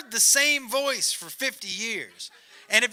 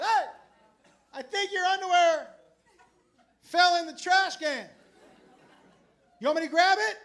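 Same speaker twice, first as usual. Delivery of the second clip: higher called out from the bathroom; mild mock shout; 8 semitones higher than usual. Tour mate calling from the bathroom, mild mock yell. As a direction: call out across the hotel room. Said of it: "So he screams out".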